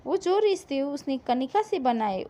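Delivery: ordinary delivery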